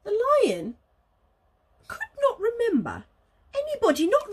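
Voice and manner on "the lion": plain reading voice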